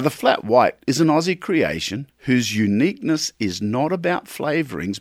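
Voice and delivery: usual speaking voice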